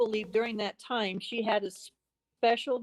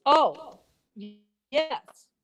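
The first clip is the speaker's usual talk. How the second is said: caught off guard; 4 semitones higher, 4 dB louder, much slower than usual. Retold or imitated. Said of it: "you can see her stutter".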